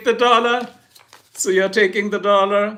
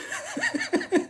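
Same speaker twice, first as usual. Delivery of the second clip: quick falsetto titter; a soft high laugh; high giggle; 8 dB quieter than usual. Real, not acted. Streamer giggles high after joking about languages.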